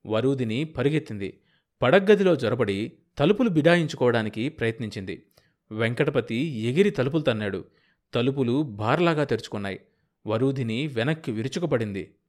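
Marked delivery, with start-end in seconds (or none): none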